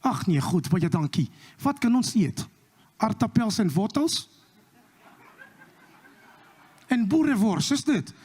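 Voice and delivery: mock Afrikaans. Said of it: "we say".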